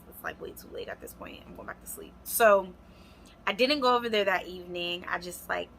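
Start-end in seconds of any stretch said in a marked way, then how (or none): 0.1-2.1 whispered aside, much softer. Real, not acted. Telling viewers she went back to sleep, whisper. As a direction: drop to a breathy whisper.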